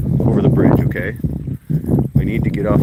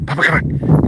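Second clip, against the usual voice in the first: higher, louder call to a dog; 8 semitones higher, 5 dB louder than usual. Real, not acted. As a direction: call the dog over eagerly. Calling the dog to run.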